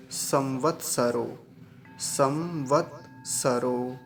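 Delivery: संवत्सरो drawn out syllable by syllable; slow sounding out. Shown as a demonstration. Teacher sounding out the next word slowly. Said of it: "अगला शब्द देखेंगे".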